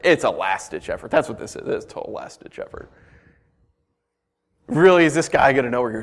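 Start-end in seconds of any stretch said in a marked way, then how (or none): 1.1-2.9 soft unvoiced whisper of the point; whispered aside. Real, not acted. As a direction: lean in and whisper it. Repeats point dropping to whisper.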